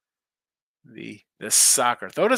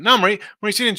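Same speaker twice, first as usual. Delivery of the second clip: loud call-out; 8 semitones higher, 8 dB louder, faster than usual. Real, not acted. Calling out to a chat viewer.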